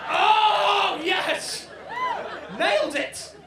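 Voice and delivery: very high, loud laughing shout; loud, high, laughing triumph; triumphant cheer. Acted. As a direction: cheer it like a winning goal. Cheering the drunk man's excuse.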